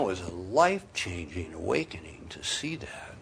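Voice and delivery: hushed awed whisper. Real, not acted. Preacher recalling his awakening to the congregation, whispered in awe. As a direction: breathe it out in wonder.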